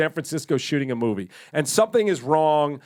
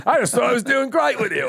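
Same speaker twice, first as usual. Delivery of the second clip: higher, fast, lightly put-on Arthur voice; Arthur quoting; 9 semitones higher, 3 dB louder, faster than usual. Arthur flirting with the hooker, quick high put-on voice. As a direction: toss it off quick and high like a tipsy charmer. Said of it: "and then he goes".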